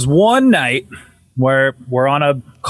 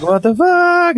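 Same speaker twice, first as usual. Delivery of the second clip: high-pitched retold yelp of alarm; startled reaction; 14 semitones higher, slower than usual. Teller recalling the eerie background noise, startled and high.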